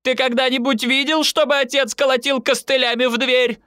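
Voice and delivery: high-pitched indignant demand; mother's outraged tirade; relentless scolding. A narrator's reading. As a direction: keep nagging, pitch held up high. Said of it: "не унималась мама".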